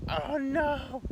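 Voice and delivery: sudden high yelp of alarm; retold panic. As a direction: squeal it, caught speeding. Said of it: "and I'm like".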